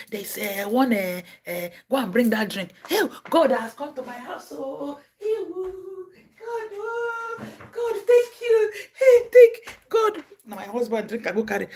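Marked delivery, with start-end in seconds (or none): 2.9-10.2 high, slow, crying shouts of thanks; mock ecstatic crying. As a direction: sob out thanks to God at the door. Mimicking an overjoyed believer.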